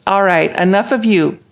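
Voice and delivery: plain reading voice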